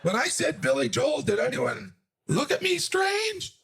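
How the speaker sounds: high, rising, anxious self-questioning; panicked inner voice. Retold or imitated. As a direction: replay every chat in a high panic. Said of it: "In my mind".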